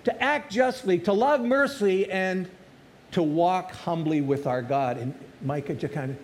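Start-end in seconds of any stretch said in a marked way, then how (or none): 0.0-5.0 rising, louder preacher's cry; loud preaching at its peak; preaching at full force. Acted. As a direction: proclaim it to the whole church.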